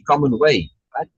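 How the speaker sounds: ordinary talk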